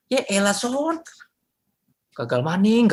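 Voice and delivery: high, mock Javanese exasperation; Javanese teasing. Friend scolding Samson in Javanese, exasperated. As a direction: tease him in Javanese dialect.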